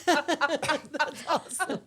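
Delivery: slow, laughing delight. Real, not acted. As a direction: stretch it out laughing. Laughing reply.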